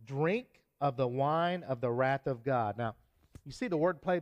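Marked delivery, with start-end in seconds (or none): 0.0-2.8 slow, weighty reading; slow declaration. Shown as a demonstration. Preacher reading the angel's warning, slow weighty declaration. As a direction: let each word land slowly. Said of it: "this is the declaration".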